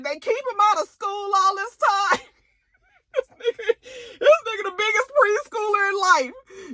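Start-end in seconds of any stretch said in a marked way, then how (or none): 3.1-6.8 much higher, laughing through it; high laughing incredulity; laughing disbelief. Real, not acted. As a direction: crack up at the absurdity. Narrator laughing at absurdity.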